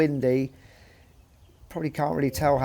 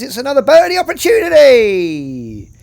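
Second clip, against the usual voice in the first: excited; 9 semitones higher, 12 dB louder than usual. Real, not acted. Hyping birdie chance.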